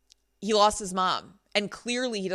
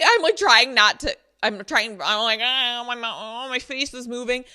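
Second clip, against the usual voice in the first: loud, higher, hammed-up frustration; mock struggle; 4 semitones higher, 7 dB louder than usual. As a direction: act out failing to keep your face still. Acts struggling to keep face still.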